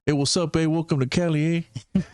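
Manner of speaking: Californian eh. Pretend. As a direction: chirp it like a Cali local saying eh. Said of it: "California is like".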